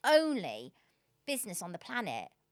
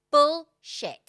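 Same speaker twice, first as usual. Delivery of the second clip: mock-defiant, louder and higher; defiant retort; 6 semitones higher, 12 dB louder than usual. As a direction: blurt it out like a retold outburst. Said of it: "And I was like".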